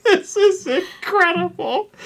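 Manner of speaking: amazed disbelief. Retold or imitated. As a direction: stretch it out in stunned amusement. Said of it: "And I'm just like".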